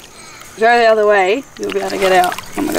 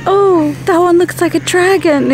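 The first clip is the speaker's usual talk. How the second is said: mock dreamy cloud gazing; 8 semitones higher than usual. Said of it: "Being like".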